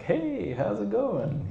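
very high sing-out hello; mock cheery greeting. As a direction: imitate a breezy, chirpy greeting way up high. Imagined cheery greeting from patient.

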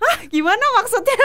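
loud, high-pitched retold shock; mock startled disbelief. Imitating manager roused from lying down by the news, startled disbelief. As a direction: jolt upright with a loud, shrill-high question. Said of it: "katanya lagi tiduran sampai bangun".

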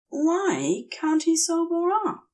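plain reading voice

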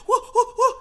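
high, loud imitated whoops; nervous crowd whoops. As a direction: hoot like an anxious crowd. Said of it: "sweating their pants off".

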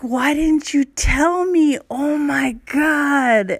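mock the shocked guy, stretching every word; hammed-up shock, slowed right down; stunned disbelief. Stunned partner voiced in story.

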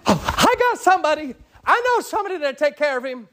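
high and quick, eager to help; eager reply. Acted. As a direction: jump in excitedly with the answer. Sister eagerly volunteers in story.

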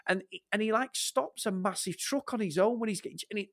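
ordinary delivery

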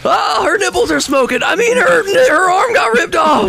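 high, hysterical yelling; panicked shriek. Acted. Reporting a friend's gruesome injury, hysterical. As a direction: cry out in horrified panic.

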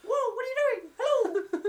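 very high, loud cartoon squeal; squeaky cartoon voice. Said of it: "We do impressions".

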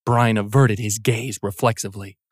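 plain reading voice

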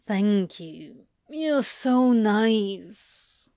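slow, drowsy mumble; sleepy mumble. Acted. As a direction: mumble it half asleep. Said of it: "he mumbled sleepily".

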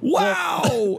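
high amazed commentator cry; mock sports announcer.

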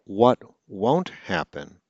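ordinary talk

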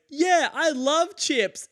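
very high, drawn-out whoop, imitating a heckler; mock yell. One guy in the crowd yells back, imitated high slow yell. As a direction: yell it slowly in a silly high voice. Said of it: "yells up and he goes".

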